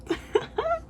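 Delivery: very high delighted giggle; real giddy fan giggle; high squealing giggle. Real, not acted. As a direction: let a squeal of laughter out. Laugh at romance scene.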